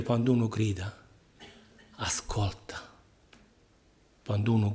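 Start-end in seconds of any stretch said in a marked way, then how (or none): 2.0-2.9 soft whisper; sussurro. Real, not acted. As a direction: drop to a hushed whisper. Hushed contrast with crying out. (sussurrato piano)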